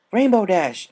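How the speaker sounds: loud call in the dark. Acted. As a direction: shout a name from far off. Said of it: "a voice beyond her called".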